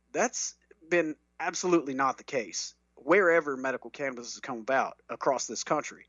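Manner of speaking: normal talking voice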